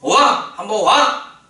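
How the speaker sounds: loud, high, excited 와! calling out; joyful call. Retold or imitated. Preacher voicing Jesus, calling Peter across the water with a joyful shout. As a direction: shout it like calling someone over in delight.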